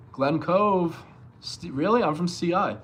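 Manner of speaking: light up at a hometown neighbour; excited surprise. Real, not acted.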